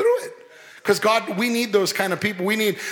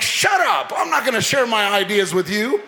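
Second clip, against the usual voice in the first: mock snap; 2 semitones higher than usual. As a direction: bark it back half-joking. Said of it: "And you're like".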